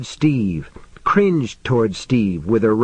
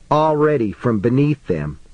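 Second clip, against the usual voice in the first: ordinary delivery, nothing marked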